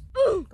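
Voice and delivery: sharp high mock gasp; dramatic gasp. Vlogger gasps at her own bad news. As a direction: gasp as if shocked at yourself.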